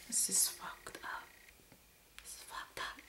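hushed, breathy whisper to herself; stunned whisper over a wrecked car; whispered dismay. Real, not acted. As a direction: whisper it in shock at the damage.

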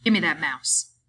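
no-nonsense command; brusque. A narrator's reading. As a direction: demand it curtly. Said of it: "he said brusquely".

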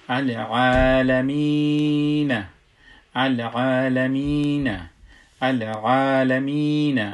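العالمین with long-held ā and ī, slowly; madd pronunciation. Shown as a demonstration. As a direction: stretch both long vowels in العالمین. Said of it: "پس ایره که ما اگر تلفظ کنیم".

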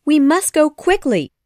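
Wendy pressing Peter to leave quickly. A narrator's reading. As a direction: urge him to hurry. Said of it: "she said".

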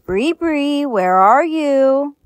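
slow, eerie sing-song call; menacing hunter. Acted. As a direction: call out like a killer stalking prey. Hunting her down menacingly.

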